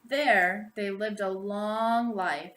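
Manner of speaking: usual speaking voice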